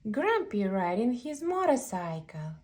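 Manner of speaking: plain reading voice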